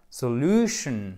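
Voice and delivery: "Solution" slowly, middle syllable stretched, then falling; stress on second syllable. Shown as a demonstration. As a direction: lean long on the LU of "Solution". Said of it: "vous avez l'accent tonique". (accent tonique sur la deuxième syllabe de « Solution »)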